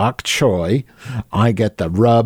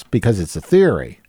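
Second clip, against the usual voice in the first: ordinary delivery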